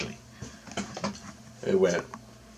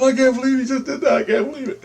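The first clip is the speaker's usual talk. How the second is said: mock amazed disbelief, much higher; imitating freaked-out friend; faster than usual. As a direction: friend stunned and crying with joy, retold. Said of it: "So he's freaking out".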